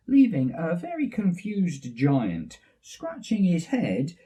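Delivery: ordinary delivery, nothing marked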